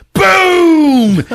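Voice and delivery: loud high boom; explosion boom. Retold or imitated. Narrator imitating the club entrance exploding, loud boom. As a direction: blast it out.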